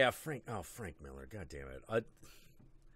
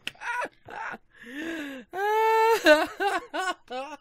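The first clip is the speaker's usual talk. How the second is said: high squealing laugh; 9 dB louder than usual. Real, not acted. Long laugh after joke.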